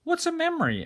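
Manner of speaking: put-on high, curious little boy voice; small boy. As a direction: ask it like a wide-eyed child.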